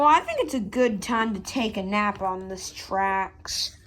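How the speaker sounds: ordinary talk, nothing marked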